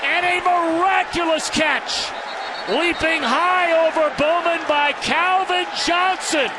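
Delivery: shouting a touchdown call, pitch way up; excited touchdown call. Real, not acted. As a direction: yell it out like a huge score. Touchdown call by play-by-play announcer.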